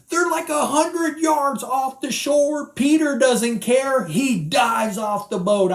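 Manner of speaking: high, loud sermon storytelling; preaching. Acted. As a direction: preach it with rising fire. Preaching the story at full force.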